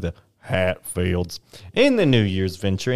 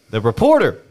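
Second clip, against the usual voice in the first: ordinary delivery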